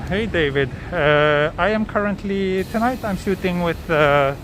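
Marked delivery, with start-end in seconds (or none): none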